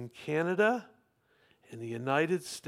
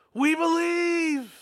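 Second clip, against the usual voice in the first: high, long, loud cheer; crowd's roar; 11 semitones higher, 5 dB louder, slower than usual. Acted. Crowd roaring back to the walker, roaring. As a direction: roar it out slowly, high and full. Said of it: "And they roared".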